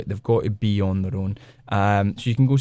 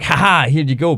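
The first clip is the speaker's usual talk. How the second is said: mock gleeful reveal; 5 semitones higher, 6 dB louder than usual. Mocking a gleeful spoiler reveal.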